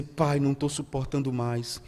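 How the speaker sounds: low, soft plea to the Father; weary plea. Acted. Worshipper pleading to God with face in the dust, weary plea. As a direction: pray it low, soft and worn. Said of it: "e dizer".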